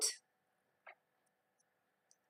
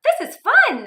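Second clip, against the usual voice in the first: higher, louder happy cry; the animals cheering on their bikes; excited cheer; 13 semitones higher, 10 dB louder, slower than usual. Acted. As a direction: cheer with delight.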